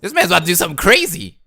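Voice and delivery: loud, high and thrilled; excited. Real, not acted. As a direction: shout it in disbelief. Reacting to Snake's last resort.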